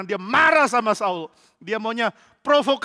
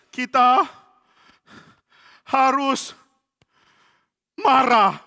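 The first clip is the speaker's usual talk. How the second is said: angry sermon; 6 semitones higher, 5 dB louder, much slower than usual. Acted. Priest's sermon turns to anger.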